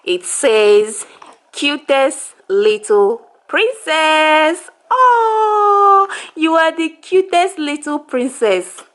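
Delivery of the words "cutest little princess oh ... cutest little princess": high, slow sing-song. Real, not acted. Mother reading the onesie to her baby, high slow baby talk. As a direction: draw it out sweetly up high.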